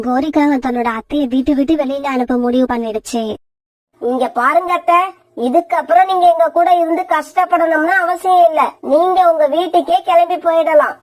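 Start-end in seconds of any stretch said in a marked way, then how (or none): 4.0-11.0 a lightly voiced sharp, sulky wife; selfish young wife. Wife Kori arguing with her husband, sulky and sharp. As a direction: complain like a spoiled wife who wants help kept on.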